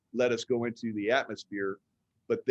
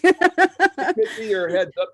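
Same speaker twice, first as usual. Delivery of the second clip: laughing; 12 semitones higher, 11 dB louder, slower than usual. Real, not acted. Laughing while joking along.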